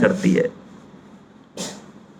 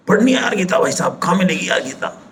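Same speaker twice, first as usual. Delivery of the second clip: mock desperate seeker; 5 semitones higher than usual. Imagined seeker begs a friend for the Gita, mock pleading. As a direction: imitate someone begging to find a book.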